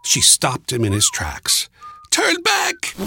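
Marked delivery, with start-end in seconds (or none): 2.1-2.9 put-on high-pitched old woman crying out a warning; old woman's cry. A narrator's reading. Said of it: "she cried".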